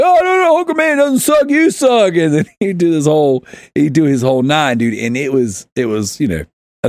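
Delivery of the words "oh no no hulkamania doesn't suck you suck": high-pitched mock outrage; imitating Bobby. Hulk Hogan fan defending his hero, mock freak-out. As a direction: mimic an indignant wrestling fan protesting. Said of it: "yelling at me and pointing".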